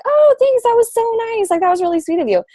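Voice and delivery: high, delighted gushing thank-you. Retold or imitated. A friend thanking her for the nice check-in, gushing high.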